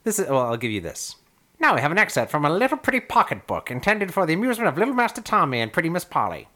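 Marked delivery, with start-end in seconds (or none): 1.6-6.5 put-on high, fast old-timey announcer voice; old-timey presenter, brisk and grand. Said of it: "reread that in your old-timey voice".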